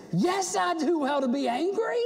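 high, indignant retort; angry, self-justifying protest; Jonah. Acted. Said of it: "Jonah's response is".

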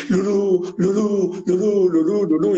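high-pitched repeated mock cries; mock yell. A narrator's reading. Joke teller as lovesick patient yelling all day, mock yell. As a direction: imitate a madman wailing one name over and over. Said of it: "todo el día gritando ahí".

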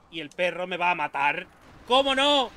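loud exasperated yell; shouting. Real, not acted. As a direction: yell it at the attacking dog. Dog attacks, shouting.

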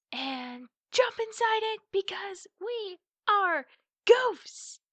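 rising to a gleeful high shout on goofs; giddy shout of fun; excited yell. Real, not acted. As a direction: burst out with glee about jumping in. Excited outburst about the leaf pile.

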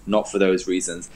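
ordinary talk, nothing marked

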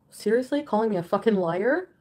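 loud rising indignant yell; outraged shout. Real, not acted. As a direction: shout it back in disbelief. Outraged at being called liar.

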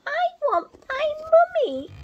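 very high, loud tiny owl wail; littlest owl. Acted. Reading to children, baby owl Bill wails for mummy. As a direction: cry for mummy like the smallest baby. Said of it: "said Bill".

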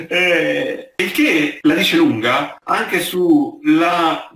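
usual speaking voice

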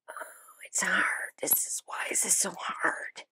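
half-joking whine of despair; fake-teary whisper; mock crying whisper. Real, not acted. Struggling to discard clothes.

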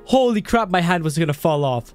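normal talking voice

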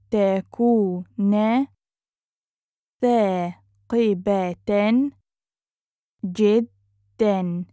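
syllable by syllable. Shown as a demonstration. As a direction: sound it out with long gaps. Teacher breaks phrase into syllables.

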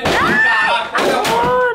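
very high, slow, amazed squeal; excited squeal. Real, not acted. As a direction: squeal with delight at the Legos. Excited at lots of Legos.

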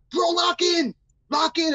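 high-pitched mock shout; imitated yelling. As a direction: holler it high like inmates across a tier. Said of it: "Everybody's yelling at him".

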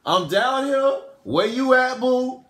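put-on high, drawn-out girly call; mock-sweet high-pitched summons; woman about to win. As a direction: imitate a woman cooing for her boo. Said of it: "that's how they talk".

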